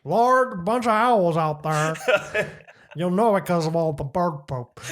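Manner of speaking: slow, drawn-out neighbor voice with a laugh; neighbor impersonation. Retold or imitated. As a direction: drag the words out like him. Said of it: "That's how he sounds".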